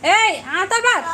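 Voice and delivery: loud, slow call to come back; a loud, drawn-out summons. Real, not acted. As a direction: shout across to stop them leaving. Calling someone back on stream.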